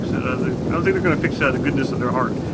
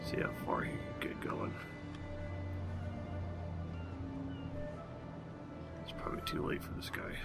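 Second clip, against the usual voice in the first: muttering; 17 dB quieter than usual. Real, not acted. Muttering while playing.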